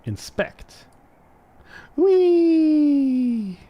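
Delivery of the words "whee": high, loud drawn-out squeal of fun; loud playful wheee; gleeful whee. Pretend. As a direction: squeal like a kid on a slide. Playfully zooming the camera.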